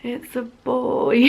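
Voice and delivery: reluctant announcement. Retold or imitated. Mum telling an excited asker the gender, half-heartedly. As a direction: drag it out with feigned cheer. Her reluctant reply, acted out.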